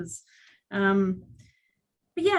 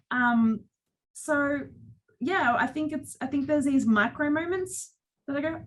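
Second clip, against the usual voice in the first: ordinary delivery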